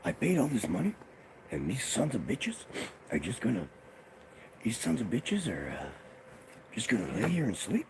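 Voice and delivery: high, loud outraged rant; ranting uncle. Retold or imitated. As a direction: rage at lions who won't perform.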